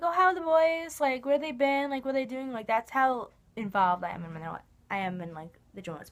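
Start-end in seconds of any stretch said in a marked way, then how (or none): 0.0-2.5 put-on much higher and louder, asking eagerly about the boys; imitating chirpy relatives; bright nosy relative questions, higher and louder; eager family. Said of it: "They're like".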